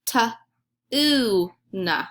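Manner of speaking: Ta, oo, na said as separate sounds with a long oo; sounding out words. Shown as a demonstration. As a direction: sound out Ta, oo, na one by one. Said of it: "Try it with me".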